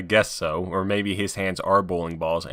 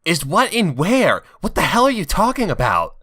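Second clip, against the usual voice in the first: baffled Rouge; 8 semitones higher, 3 dB louder than usual. Acted. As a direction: demand an answer, bewildered. Rouge confused by Knuckles.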